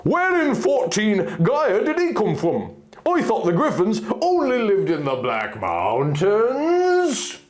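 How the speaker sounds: stunned guard. Acted. Guard Palin wondering where the griffin came from, bewildered. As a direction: ask it still reeling.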